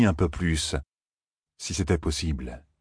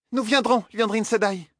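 eager cry. A narrator's reading. Egwene pledging to go with the sister, eager higher cry. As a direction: blurt out the promise. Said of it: "s'écria Egwene".

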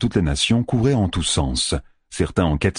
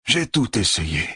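désespéré; 3 semitones higher than usual. A narrator's reading. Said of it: "répéta Flynn, désespéré".

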